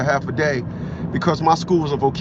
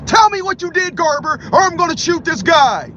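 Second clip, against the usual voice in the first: high, loud threatening yell; furious shout; 13 semitones higher, 4 dB louder than usual. Retold or imitated. Terrorist threatening to shoot the hostage, high loud furious yell. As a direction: scream the threat at full force.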